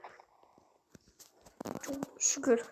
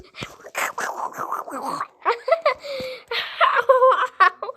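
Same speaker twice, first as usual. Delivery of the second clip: whispered giggle; 6 semitones higher, 4 dB louder than usual. Acted.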